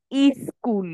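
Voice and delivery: "ই স্কুল" with an extra ee before the s; regional added vowel. Shown as a demonstration. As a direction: put a separate ee in front of "স্কুল". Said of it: "আঞ্চলিকতার কারণে অনেকে". ("ই স্কুল", আঞ্চলিক টানে সামনে ই বসিয়ে)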